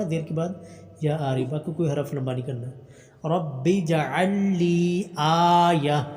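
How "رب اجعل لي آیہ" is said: slow drawn-out tajweed chant; slow tarteel recitation; Quran recitation. Shown as a demonstration. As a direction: recite each vowel long and measured. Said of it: "حمزہ کو لمبا کرنا".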